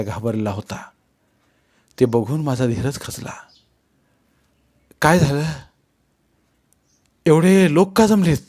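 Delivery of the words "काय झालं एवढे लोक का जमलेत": anxious, raised pitch asking; frightened question. A narrator's reading. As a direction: ask fearfully, voice going up. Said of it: "मी घाबरून विचारलो". (घाबरलेल्या आवाजात प्रश्न)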